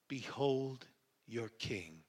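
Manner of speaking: slow, soft, spaced-out words; solemn presentation. A narrator's reading. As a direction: present him quietly and slowly. Pilate solemnly presents Jesus.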